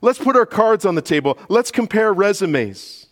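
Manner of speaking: high, loud boastful sneer; mocking boastful rivals. Preacher mocking boasters with a loud high sneer. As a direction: puff up like proud rivals. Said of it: "he says".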